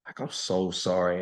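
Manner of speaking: soft, tearful sorry, lightly done; retold crying apology. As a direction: quietly retell a tearful apology. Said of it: "And I'm just crying".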